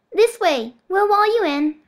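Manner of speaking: put-on high, loud childish coaxing; little girl voice. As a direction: call him along like a child. Bonesaw leading him.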